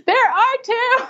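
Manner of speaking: very high, squealed reenactment; excited announcement. Retold or imitated. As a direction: imitate blurting big news in a high voice. Retold breaking of twin news.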